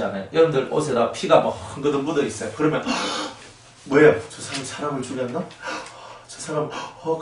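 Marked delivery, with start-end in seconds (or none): 3.3-4.1 깜짝 놀라 숨 들이쉬며; 겁먹고 헉 하고 놀라. Pretend. 4.3-7.2 겁먹은 속삭임. Pretend. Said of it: "무서워하면서".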